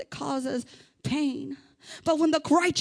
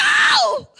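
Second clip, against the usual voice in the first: high drawn-out yowl; full-volume acted "Ow!" shriek; loud cry of pain; 11 dB louder than usual. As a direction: howl as if you just stubbed your toe. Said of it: "when I stub my toe, I'm like".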